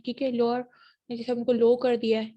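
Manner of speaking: ordinary delivery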